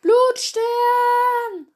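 high, loud charge call; full-throated war cry; battle cry. Acted. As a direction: yell the attack order. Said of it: "rief Skorpionschweif".

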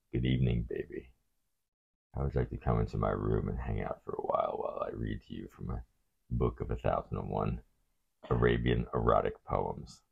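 low, soft bedroom murmur; deep seductive voice. Acted. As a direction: read like an erotic audio performer. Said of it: "that deep voice".

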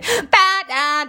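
high, slow ba-da-dun fanfare; dramatic sting. Pretend. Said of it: "Maybe I gotta be more dramatic".

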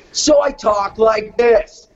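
drawn-out, obnoxious cartoon kid; slow it into a bratty twelve-year-old's voice; obnoxious pest voice. Shown as a demonstration. Showing his audition voice for a cartoon pest, obnoxious. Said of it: "he's an obnoxious".

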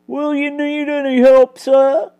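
put-on a polite young constable. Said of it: "asked Cluis".

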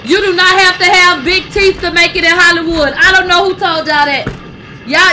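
loud, high, insistent scolding; heated rant. Real, not acted. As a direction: tell them off at full volume. Ranting at fans about big teeth.

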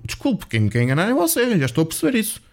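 high-pitched retort, acted out; worked-up retold accusation. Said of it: "já mais exaltado".

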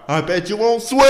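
mock yell. Driver yelling out the car at workers, mock taunt. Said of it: "Someone yelled".

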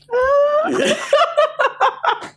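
shrieking laugh, way up high; high burst of laughter. Real, not acted. Listener learns she's the traveller.

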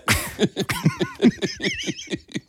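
high shrieking laugh. Real, not acted. Cracking up after exchange.

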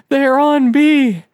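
high, loud, slow mock callout; mocking imitation of a shouted callout; mimicked broken-English shout. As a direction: parrot the game soldier, stretching the words. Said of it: "He sounds like a fucking".

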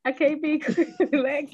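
high-pitched retold helplessness; exasperated protest. Retold struggle to relax on table.

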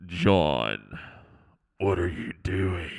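deep, loud, thundering voice from the heavens; booming movie God voice. Pretend. Preacher imitating how movies make God sound, booming and deep. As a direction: boom it out like Darth Vader calling down. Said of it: "that's Darth Vader".

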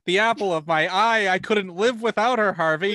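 loud, high melodramatic plea; mock pleading Gordon. Gordon begging Harvey to spare his wife, loud melodramatic plea. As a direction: ham up a lovestruck husband begging. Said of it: "he should have been like".

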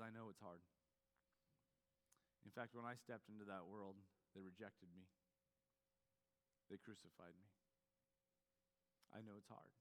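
low, hushed and measured, with pauses; gentle understanding. Acted. God answering a struggling believer who says life is hard, gentle and hushed. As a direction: answer softly and low, leaving room between phrases. Voices God's understanding reply.

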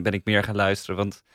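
ordinary talk

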